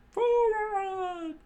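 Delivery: very high, screechy echo of a battle cry; screamed command. A narrator's reading. Crew takes up the attack order, high screech. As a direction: shriek the charge in falsetto, drawn out.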